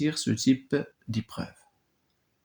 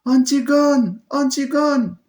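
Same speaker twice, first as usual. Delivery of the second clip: very high, loud cry of the name twice; 14 semitones higher, 7 dB louder than usual. Acted. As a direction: call after her sister in despair. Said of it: "Ismène sort en criant derrière elle".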